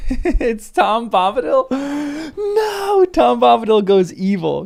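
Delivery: high, loud burst of mock outrage; gleeful shriek at an absurd idea; excited disbelief. Real, not acted. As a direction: squeal it like a wild theory just hit. Joking reaction while reading.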